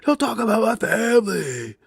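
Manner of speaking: high, loud, slow mock hype promo; mocking hype wrestler. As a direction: ham up a hyped-up promo. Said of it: "he comes out there and he's like".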